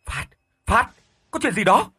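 terrified brother yelling into the receiver; frightened loud call. Acted. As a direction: shout his brother's name down the phone. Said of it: "ông liền gọi to".

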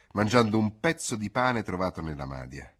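ordinary delivery, nothing marked

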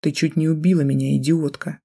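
ordinary delivery, nothing marked

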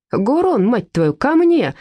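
безнадёжный крик; hopeless yell. A narrator's reading. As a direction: call the dog back in vain. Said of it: "безнадёжно кричал Курищев".

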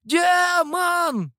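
very high, drawn-out scream of alarm; loud, panicked old man's wail; old man's yell. A narrator's reading. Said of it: "завопил старик".

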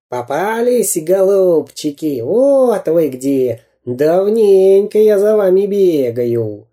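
cartoon villain crowing in triumph; gloating villain spy. A narrator's reading. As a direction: savour catching them at last.

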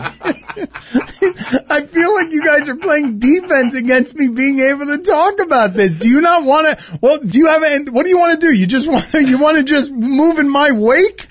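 high, amused needling; teasing. Real, not acted. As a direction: needle them with a grin. Host teasing co-host's avoidance.

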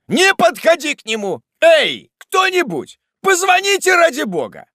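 very high, loud cries for help; frantic, high-pitched alarm; panicked shouting. Acted. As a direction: shout a warning, then call frantically for help. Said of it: "закричал Уардель".

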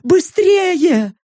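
acted alarm. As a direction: urge them on in panic. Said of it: "продолжила свой спектакль наёмница".

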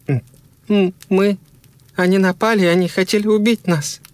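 high, stuttering self-defence; stammer, then plead your case in a high voice; shocked stammering. A narrator's reading. Shocked wife stammering a justification.

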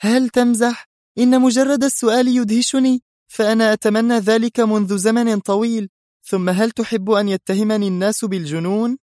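high, thrilled woman's voice; bubbling, high-pitched excitement; beaming delight. A narrator's reading. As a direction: answer overjoyed, almost incredulous. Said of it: "وتهللت ملامحها وهي تقول".

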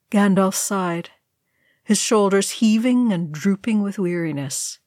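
usual speaking voice

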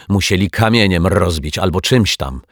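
ordinary delivery, nothing marked

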